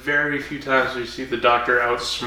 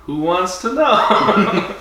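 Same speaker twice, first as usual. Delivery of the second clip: defiant; 7 semitones higher, 6 dB louder, much slower than usual. Retold or imitated. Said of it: "You go".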